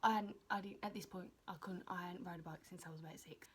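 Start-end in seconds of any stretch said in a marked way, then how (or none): none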